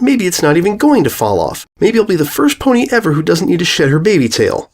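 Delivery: high smug bragging voice; boastful filly. Acted. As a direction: brag about the tail. Bully boasts.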